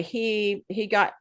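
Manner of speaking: usual speaking voice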